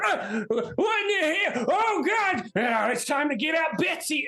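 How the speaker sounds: high, panicked yelp after being shot; startled yelp. Acted. Shot villager panicking and fleeing. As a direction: yelp it out in sudden pain and panic.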